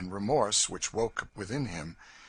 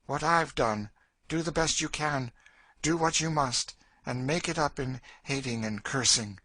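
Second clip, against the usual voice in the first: bitter advice, pitched up; hardened Cassie; 7 semitones higher, 4 dB louder than usual. Acted. Woman tells girl how to endure, hardened and bitter. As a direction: counsel her with hard resignation.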